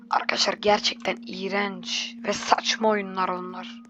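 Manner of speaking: low whisper to viewers. Real, not acted. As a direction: whisper the complaint. Commenting quietly to viewers.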